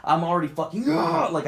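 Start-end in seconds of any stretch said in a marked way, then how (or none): 0.9-1.3 high pent-up "ah" of rage; acted burst of rage; enraged growl. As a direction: let out a high, raging cry. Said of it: "I'm fucking raged up".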